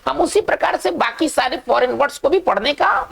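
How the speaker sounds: ordinary talk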